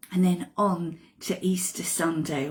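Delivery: ordinary talk, nothing marked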